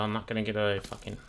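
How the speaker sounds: ordinary talk, nothing marked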